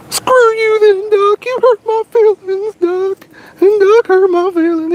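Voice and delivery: high-pitched whiny fake cry; mock crying. Angler to the duck that refused his bait, mock crying.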